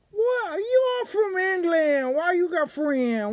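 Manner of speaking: very high, slow, silly character voice; corn dogging guy. Acted. Corn dogging guy quizzing an English guy, high slow voice. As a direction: draw out questions in a high put-on voice. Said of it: "I do the corn dogging guy".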